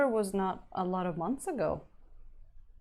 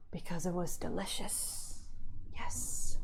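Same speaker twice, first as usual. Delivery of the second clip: creepy monster whisper; 4 dB quieter than usual. Pretend. Mind flayer answers in whisper.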